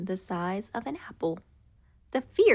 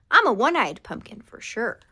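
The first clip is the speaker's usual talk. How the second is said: proud boast; 3 semitones higher, 7 dB louder than usual. Acted.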